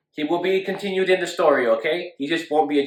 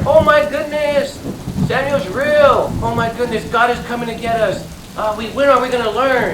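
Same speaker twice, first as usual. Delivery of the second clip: scared people; 7 semitones higher than usual. Acted. The frightened people react to the thunder, high panicked voice. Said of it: "the people were all scared".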